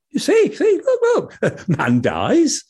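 a small eager animal calling up to the sky; high chirpy rabbit, stammering; excited rabbit. Acted. Rabbit shows the dying man.

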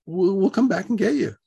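placating repeat. Retold or imitated. Repeats promise after shocked What.

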